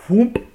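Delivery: high, sudden whoomp; imitated whoomp. Comic telling his mishap to listeners, high imitated whoomp. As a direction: pop out a quick sucking-up noise.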